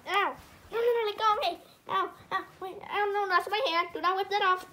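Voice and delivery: high, loud cries of pain; pained protest. Acted. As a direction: yelp as if your hand is being crushed. Character grabbed, crying out in pain.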